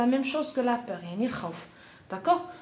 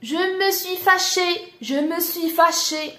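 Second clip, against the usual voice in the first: raised pitch, mock indignation; emphatic higher-pitched show of being cross; acted crossness; 6 semitones higher, 4 dB louder than usual. Shown as a demonstration. French teacher modelling how to say one got cross, indignantly.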